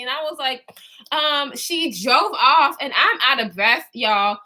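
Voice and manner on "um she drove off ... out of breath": normal talking voice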